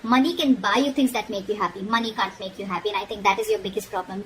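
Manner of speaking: normal talking voice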